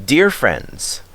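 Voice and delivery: ordinary delivery, nothing marked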